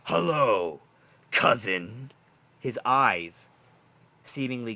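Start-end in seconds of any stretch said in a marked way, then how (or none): 0.0-2.1 snake cousin greeting. Acted. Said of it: "looks at you and says".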